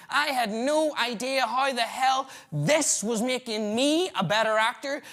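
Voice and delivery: normal talking voice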